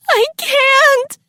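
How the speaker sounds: very high, despairing shriek; scream. A narrator's reading. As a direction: scream in helpless panic. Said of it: "Sue screamed".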